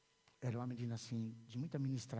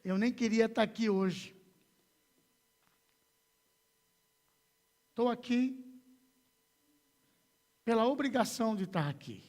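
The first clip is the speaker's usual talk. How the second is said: raised, higher voice with long pauses; loud emphatic quote; 10 semitones higher, 10 dB louder than usual. Retold or imitated. Preacher repeats a guest's reluctant confession to the congregation, loud and emphatic. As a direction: quote the confession forcefully, letting it land.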